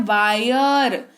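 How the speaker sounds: "वायर" stretched slowly so the आय glide is heard; slow clear "wire". Shown as a demonstration. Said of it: "ऐसे सुनाई दे रहा है". ("वायर" को धीरे खींचकर बोलना)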